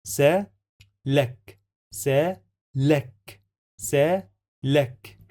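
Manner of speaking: سلك said slowly, stopping on the final ك; pause on the kaf. Shown as a demonstration. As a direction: hold and stop on ك in سلك. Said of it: "I have to pause here".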